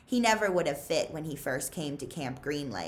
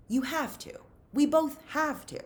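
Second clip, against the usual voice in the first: higher, pressing plea; insistent plea, higher pitched; urgent insisting; 10 semitones higher than usual. A narrator's reading. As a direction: push him to come back. Stanley insists Zero return.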